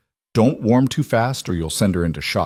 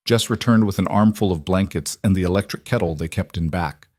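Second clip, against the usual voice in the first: usual speaking voice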